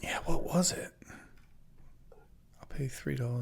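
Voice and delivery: soft unvoiced whisper to himself. Real, not acted. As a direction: whisper it while reading. Muttering aside while reading website.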